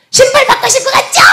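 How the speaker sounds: very high-pitched screamed accusation; shrill yelling. Retold or imitated. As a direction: imitate an angry woman yelling down the phone. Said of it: "이러고 소리 지르는 거예요".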